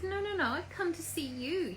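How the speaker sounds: high, insistent objection; flustered protest. Acted. Phyllis protests in acted dialogue.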